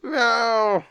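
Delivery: high crying yowl of a cat; howling cat cry; wailing cat meow. Acted. Meowing escalates into crying shout.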